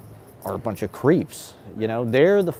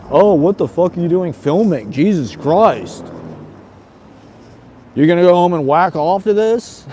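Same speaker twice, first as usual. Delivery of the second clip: loud, higher mock outrage; outraged heckler; 7 semitones higher, 8 dB louder than usual. Onlooker scolding someone for filming, loud mock outrage. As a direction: berate him like an appalled onlooker, voice raised. Acts out hecklers piling on him.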